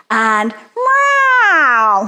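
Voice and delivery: loud falling angry meow; angry cat meow. Shown as a demonstration. Teacher leading kids' warm-up, loud angry cat meow. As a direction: yowl like a cross cat. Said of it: "be an angry Halloween cat".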